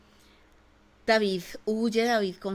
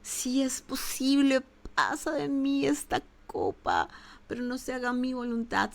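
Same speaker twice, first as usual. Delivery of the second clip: a lightly voiced Jesus, pleading higher in pitch; a hint of an anguished Jesus in the garden; Jesus praying; 5 semitones higher than usual. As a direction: cry out to the Father, higher and strained. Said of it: "Y es donde Jesús dice".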